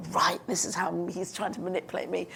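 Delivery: soft, breathy whisper to herself; whispered thought. Retold or imitated. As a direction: whisper it as a private suspicion.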